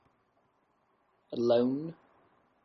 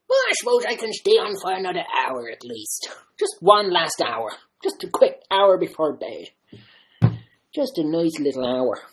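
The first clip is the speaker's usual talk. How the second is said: higher, louder, hammed-up sly excuse; mock giving in; 5 semitones higher, 5 dB louder than usual. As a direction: pretend to cave in theatrically. Pretends to give in to likes.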